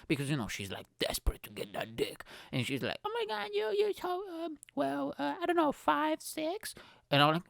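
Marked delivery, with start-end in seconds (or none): 3.0-6.7 put-on hesitant girl. Girl guessing his height, high hesitant voice. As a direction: guess timidly.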